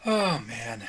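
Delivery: usual speaking voice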